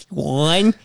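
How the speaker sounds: Mike Tyson counting. Retold or imitated. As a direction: count it out like a ref.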